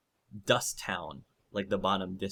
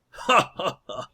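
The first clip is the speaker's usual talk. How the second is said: high, louder staged laughter; scornful mock laugh; 9 semitones higher, 6 dB louder than usual. Branka laughing at the idea of the surface, mocking laugh. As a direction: laugh it out in separate ha's. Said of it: "Branka laughs".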